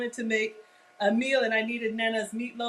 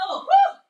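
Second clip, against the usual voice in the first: loud whew; 13 dB louder than usual. Real, not acted. Opening the hot oven.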